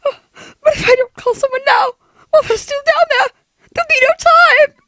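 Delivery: high, crying desperation; panicked. Acted. Woman alone deciding to call for help, panicked. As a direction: blurt it out in rising panic.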